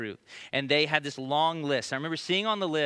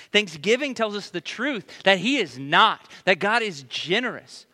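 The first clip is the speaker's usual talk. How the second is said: louder, slower declaration; emphatic preaching; 6 semitones higher, 7 dB louder, slower than usual. Acted. Pastor declaring God is generous, emphatic preaching. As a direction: land the truth firmly.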